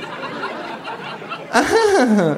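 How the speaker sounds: very high mocking creature laugh; kangaroo laugh. The kangaroo laughs along with the hostess, high and mocking. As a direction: laugh along with mock glee. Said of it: "lacht auch das Känguru".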